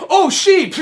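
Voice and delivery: high, loud mock exclamation; sudden excited interjection. Distracted kid breaking off mid-sentence at sheep outside, mock excitement.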